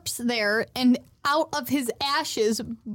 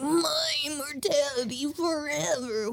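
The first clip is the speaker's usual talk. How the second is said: higher, drawn-out villain lament; mock villain wail; 4 semitones higher, slower than usual. Acting the villain's cry.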